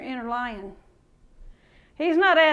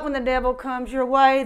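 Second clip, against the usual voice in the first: normal talking voice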